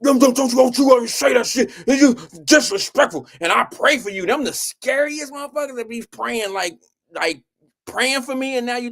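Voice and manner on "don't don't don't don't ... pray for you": high, loud, hammed-up scolding; mock angry scolding. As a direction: imitate the friend snapping at him.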